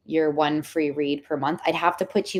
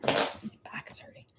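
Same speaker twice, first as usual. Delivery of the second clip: whisper; 11 dB quieter, faster than usual. Real, not acted. Whispered aside off mic.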